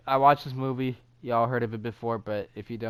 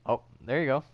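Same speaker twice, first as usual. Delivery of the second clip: ordinary delivery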